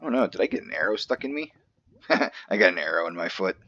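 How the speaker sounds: ordinary talk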